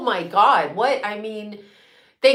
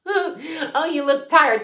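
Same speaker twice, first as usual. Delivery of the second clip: a sing-song mock of a rude stranger, higher; mocking imitation; 5 semitones higher, slower than usual. Mimicking people who tell her she looks tired, sneering. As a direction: parrot the remark with a sneer. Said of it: "That's like saying".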